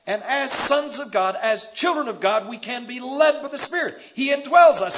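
from firm to a high, loud cry on "indwells"; building to a shout. Acted. Preacher proclaiming to the congregation that the Spirit indwells them, rising to a shout. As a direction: climb until you shout it out.

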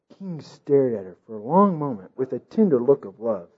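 plain reading voice

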